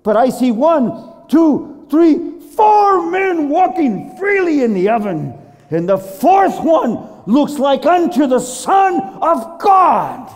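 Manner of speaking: loud, high, mounting proclamation; astounded king. Acted. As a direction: count out in amazement, then declare it.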